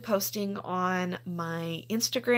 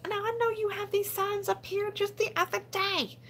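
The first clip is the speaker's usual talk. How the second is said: much higher, incredulous protest; indignant retort; 13 semitones higher than usual. Retold or imitated. Shopper confronting staff who took signs down, indignant high protest. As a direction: call out the sign thief in disbelief. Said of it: "I'm like".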